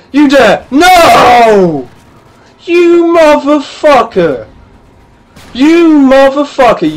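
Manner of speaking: furious high shouting; loud, high rage at a stolen kill; raging. Real, not acted. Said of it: "I liked my raging".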